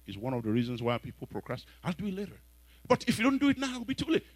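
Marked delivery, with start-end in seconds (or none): 1.8-4.3 procrastinator's voice. Retold or imitated. Voicing the procrastinator.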